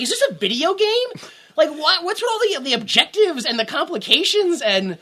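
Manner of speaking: high, incredulous questions; mock disbelief. Mocking the dad's exposition dump, high disbelief. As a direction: pitch it up in disbelief. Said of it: "I'm like".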